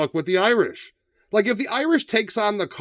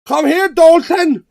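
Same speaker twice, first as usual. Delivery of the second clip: much higher and louder, slow hammed-up Irish bark; a thick put-on Irish accent; mock McGregor line; 11 semitones higher, 12 dB louder, slower than usual. McGregor reading his line for the speech coach, hammed-up Irish. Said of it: "his speech coach is like, "Let me hear what you got".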